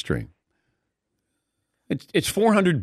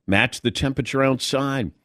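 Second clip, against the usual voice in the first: usual speaking voice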